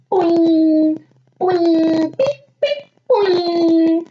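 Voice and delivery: high, loud cartoon boings; springy boing sounds. A narrator's reading. Narrator voicing hair popping up, loud and high.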